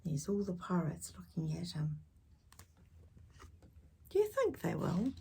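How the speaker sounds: ordinary delivery, nothing marked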